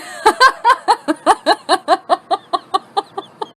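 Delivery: high, rapid peal of laughter; long laugh. Real, not acted. Storyteller cracking up after her own punchline, long laugh. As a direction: laugh on and on, trailing away.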